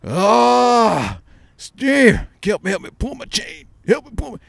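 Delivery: high, whimpering mock cry for help; pitiful old man's plea. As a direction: beg like a frail old man, hamming it up. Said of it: "you know".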